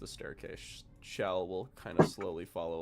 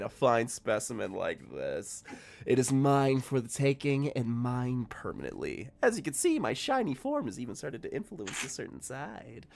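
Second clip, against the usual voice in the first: smug Shell voice; 3 semitones higher, 9 dB louder than usual. Acted. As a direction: sneer like a villain showing off a stolen body. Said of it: "Shell goes".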